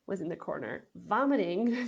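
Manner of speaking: normal talking voice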